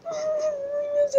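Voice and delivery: high panicked cry of Ai, meu Deus; shocked squeal. Real, not acted. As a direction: shriek in real panic at the reply. Shocked by her friend's reply.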